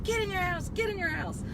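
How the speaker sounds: high commanding repeated order; police order. Retold or imitated. Police ordering neighbors off the street, high shouted command. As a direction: bark it like a cop clearing the street. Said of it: "police were saying".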